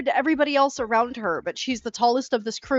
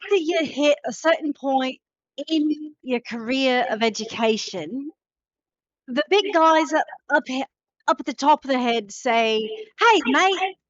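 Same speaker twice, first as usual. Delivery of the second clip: put-on Australian accent; 3 semitones higher than usual. The drow Jolene tells the party why she left home, in an Australian accent. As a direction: play her Aussie, casual and matey. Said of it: "'Cause the accent is flawless".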